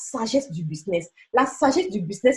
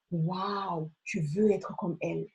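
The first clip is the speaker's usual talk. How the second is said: awed admiration; 4 semitones lower, 10 dB quieter, slower than usual. Pretend. Imagined onlooker admiring a woman.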